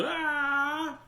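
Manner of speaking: high, drawn-out scream; frightened yell. A narrator's reading. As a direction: cry out high in sudden fright. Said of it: "And the man yelled".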